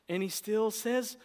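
usual speaking voice

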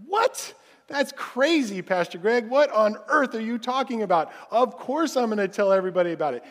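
high, loud disbelieving outburst; hammed-up shocked objection, quickening at the end; incredulous congregant. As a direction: protest in mock astonishment, starting very high. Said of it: "You're like".

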